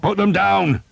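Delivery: high battle shout. Acted. Skeet ordering archers to fire, shouting high and loud. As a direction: yell the order across the field. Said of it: "Skeet shouted".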